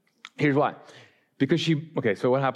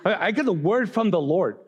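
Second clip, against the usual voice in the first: insistent protest; 6 semitones higher than usual. Retold or imitated. Boyfriend pulling out all the guns against a breakup, insistent protest. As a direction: play a hammed-up last-ditch objection. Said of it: "I was pulling out all the guns".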